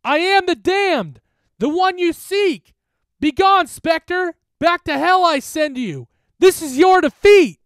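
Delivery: all-out shouted chant; screamed incantation. A narrator's reading. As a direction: scream the spell as loud as you can. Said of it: "we screamed".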